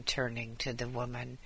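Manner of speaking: usual speaking voice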